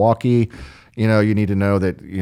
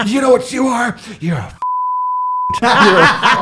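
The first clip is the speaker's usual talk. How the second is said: gorilla-like fury; 13 semitones higher than usual. Retold or imitated. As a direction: puff up and yell, imitating an enraged friend. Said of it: "gorillaed up on her like this".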